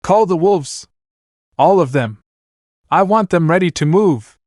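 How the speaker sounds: high-pitched commanding call; mild shout. A narrator's reading. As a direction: lightly shout orders to underlings. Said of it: "jumped into his factory and shouted".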